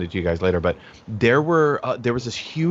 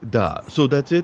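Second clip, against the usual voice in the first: put-on Russian accent. Joining the joke with a one-word sign-off, Russian accent. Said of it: "like, a fucking Russian accent".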